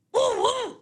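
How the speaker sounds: high Popeye toot; a high pipe toot. Shown as a demonstration. Popeye toot after impression.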